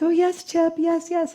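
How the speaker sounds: high fawning mock fan voice; gushing fan. Mocking the fans who fawn over Chip, high gushing. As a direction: gush over the psychic like a starstruck fan.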